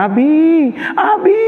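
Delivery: put-on high frightened child's call for Abi; scared child calling. Young daughter calling her father during thunder, frightened and high. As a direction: cry out for dad like a scared little girl.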